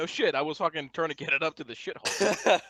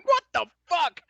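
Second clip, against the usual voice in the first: mock shocked; 13 semitones higher, 7 dB louder than usual. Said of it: "We stand back up going".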